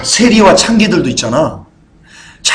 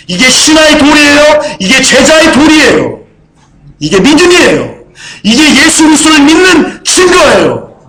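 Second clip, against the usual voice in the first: higher, louder impassioned sermon climb; fervent pulpit preaching, louder and higher; preaching at full force; 9 semitones higher, 5 dB louder than usual. Acted. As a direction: preach it rising to a shouted peak. Preaching climax.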